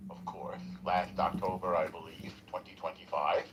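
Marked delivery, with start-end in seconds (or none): none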